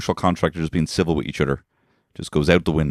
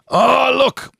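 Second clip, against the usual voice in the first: very high, louder cry; mock surprise; 6 dB louder than usual. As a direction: squeal it out in fake alarm. Sudden mock exclamation.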